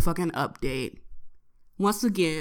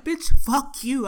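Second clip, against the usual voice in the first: loud, raised, drawn-out retort; annoyed comeback; 6 semitones higher, 8 dB louder than usual. Retold or imitated. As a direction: act out snapping back at him. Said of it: "I'd be so annoyed".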